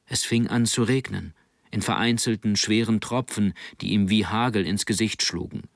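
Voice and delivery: plain reading voice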